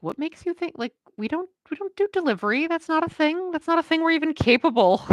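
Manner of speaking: can't believe anyone would ask this; incredulous. Real, not acted. Incredulous rant about delivery demand.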